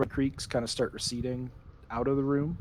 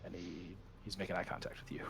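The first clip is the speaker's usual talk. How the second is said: fast creepy whisper; 5 semitones lower, 11 dB quieter, faster than usual. A narrator's reading. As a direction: hurry it out in a hushed whisper. GM describing creepy red face.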